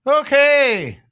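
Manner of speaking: high, loud Scottish-style okay; mock Scottish accent. Host gamely takes up the accent request, mock Scottish accent. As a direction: launch into a hammed-up Scottish brogue. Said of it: "read it in a Scottish accent".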